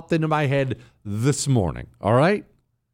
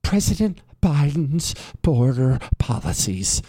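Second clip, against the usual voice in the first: a hint of the senator's hushed, earnest rebuttal voice; mimicking Katie Britt. Host mimicking the senator's rebuttal, lightly earnest. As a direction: lightly copy her breathy earnest delivery. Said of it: "She got crushed for that".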